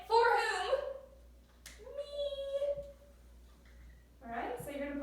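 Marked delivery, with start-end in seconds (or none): 0.1-2.8 much higher, loud, sing-song; high playful answer. Shown as a demonstration. Teacher leading students to the answer, high and playful. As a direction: pop the answer up brightly.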